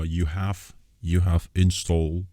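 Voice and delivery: ordinary talk, nothing marked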